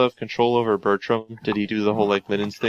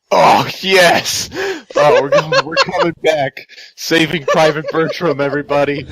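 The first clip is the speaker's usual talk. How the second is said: high, loud gleeful outburst; excited cheer; 7 semitones higher, 5 dB louder than usual. Real, not acted. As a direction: celebrate loudly, rallying the whole group. Player cheers on revenge plan.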